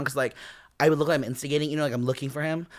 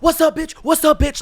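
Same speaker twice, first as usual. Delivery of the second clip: high, loud taunting challenge; mock a loud challenger from afar; calling across table; 13 semitones higher, 8 dB louder than usual. Boy challenging her from across the table, loud taunt. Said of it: "from across the table".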